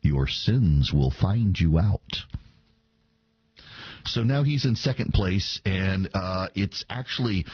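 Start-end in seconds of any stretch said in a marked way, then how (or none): 0.0-2.2 low, slow, weighty pronouncement; solemn scripture quote. Acted. Host quotes scripture at the pastor, slow and ominous. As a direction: intone it as a grave warning. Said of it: "To quote scripture".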